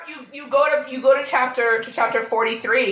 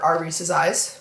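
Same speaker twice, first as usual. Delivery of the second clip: usual speaking voice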